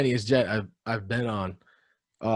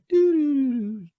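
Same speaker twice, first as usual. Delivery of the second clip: high drawn-out "doo-doo" noise; imitated eerie tune; 14 semitones higher, slower than usual. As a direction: sing out a slow, high spooky doo-doo. Imitates eerie zombie movie sound.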